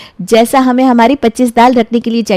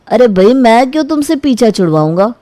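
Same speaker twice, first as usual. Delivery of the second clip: usual speaking voice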